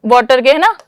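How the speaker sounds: normal talking voice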